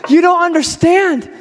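insistent pleading. Retold or imitated. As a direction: play the shameless caller who won't give up. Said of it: "he keeps on knocking".

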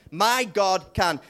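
slow, loud preacher's proclamation; emphatic declaration. Real, not acted. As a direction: proclaim it with full conviction. Congregation call and response.

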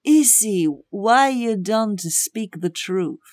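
slow, intense Eastern European accent; Slovenian accent. Shown as a demonstration. Imitating an intense friend questioning Izzy, Slovenian accent. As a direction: address her gravely in a Slovenian accent. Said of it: "this is Slovenian".

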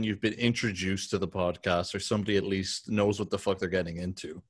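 normal talking voice

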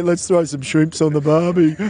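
mock Aussie cliche voice; imitating an Australian accent. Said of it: "Next minute you'll say".

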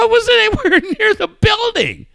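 high, loud protest breaking into a laugh; retold protest. Defending himself to the boss, high and laughing. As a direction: yell your innocence, laughing.

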